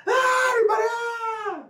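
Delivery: high cheering yell that fades out; mock crowd cheer. Recounting the dance-off to friends, high mock cheer. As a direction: hype it up like the whole crowd.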